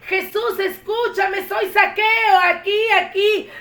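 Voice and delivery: high, loud calls from up a tree; man in a tree calling to Jesus; shouting from a tree. Acted. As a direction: wave and yell for attention. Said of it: "y de ahí empezó a gritar".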